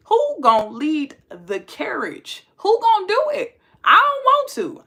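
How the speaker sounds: high and loud, fed up; exasperated protest. Real, not acted. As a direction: throw up your hands, high and loud. Exasperated protest about dating.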